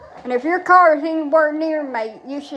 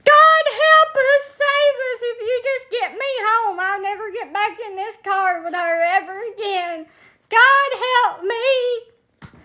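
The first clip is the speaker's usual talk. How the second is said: terrified grandma praying; 9 semitones higher than usual. Retold or imitated. Grandma praying to survive granddaughter's driving, imitated wailing prayer. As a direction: beg God like a grandma sure she'll die.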